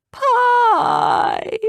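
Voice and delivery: high wailing whimper. A narrator's reading. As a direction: wail the word like a child. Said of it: "Scout whimpered sadly".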